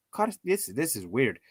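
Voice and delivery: ordinary talk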